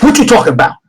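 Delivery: high, loud snap; angry retort. Acted. Leroy snapping at the stranger, loud and high. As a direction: bristle at the question. NPC reacts angrily.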